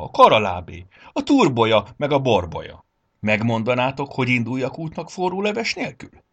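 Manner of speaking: breathless indignant rant; outraged string of questions, piling up; indignant shouting. A narrator's reading. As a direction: shout it almost in one breath. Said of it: "kiáltotta szinte egy szuszra".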